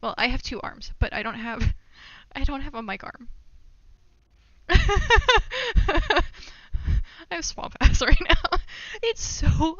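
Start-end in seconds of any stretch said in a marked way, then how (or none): 4.7-9.8 high, loud words through laughter; laughing hard. Real, not acted. Streamer confessing to sweating badly, laughing loudly. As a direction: crack up while confessing.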